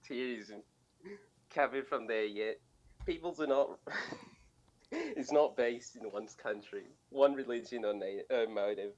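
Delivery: scuffed, drugged-out Aussie accent, cracking up; Australian accent. Shown as a demonstration. Co-host imitating a student's paper for laughs, crazy Australian accent. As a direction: read it in a badly faked Australian accent. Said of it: "drugged out accent".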